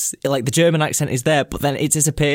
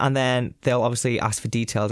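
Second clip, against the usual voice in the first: ordinary talk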